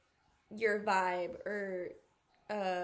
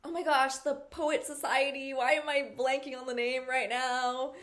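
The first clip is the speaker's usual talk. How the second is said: ordinary delivery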